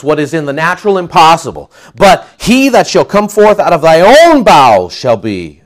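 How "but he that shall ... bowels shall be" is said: loud, high, ringing declaration; proclaiming God's promise. Acted. Preacher proclaiming God's promise to Abram. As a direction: announce the impossible promise with force.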